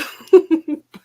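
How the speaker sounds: a high, loud burst of laughter; a relieved laugh breaking through; teary laugh. Real, not acted. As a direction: laugh out the emotion. Emotional laugh after sad story.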